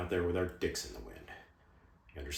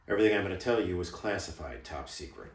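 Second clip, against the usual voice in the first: usual speaking voice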